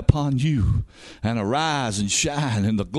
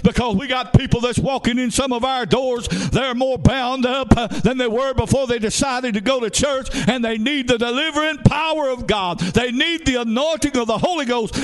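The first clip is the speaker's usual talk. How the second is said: high-pitched, driving revival preacher; fervent preaching; 9 semitones higher than usual. Real, not acted. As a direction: drive it home at a raised, sustained pitch. Preaching at full force.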